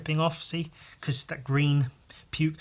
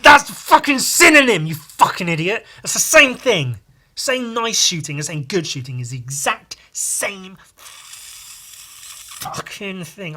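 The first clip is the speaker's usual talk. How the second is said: shouting, high and loud, at an idiot on screen; exasperated yelling at a film character; furious rant; 6 semitones higher, 7 dB louder than usual. Retold or imitated. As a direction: tear into him like you want to slap him. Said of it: "I just wanna slap him and be like".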